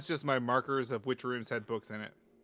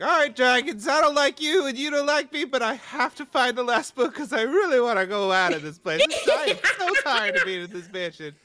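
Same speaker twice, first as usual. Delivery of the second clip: loud, higher exasperated yell at dragons; defiant character shout; 8 semitones higher, 7 dB louder than usual. Acted. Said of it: "So he's gonna be like".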